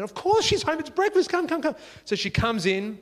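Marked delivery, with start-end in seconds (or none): 0.0-1.8 put-on eager kid. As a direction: usher her in like an excited child. Boy eagerly invites her in.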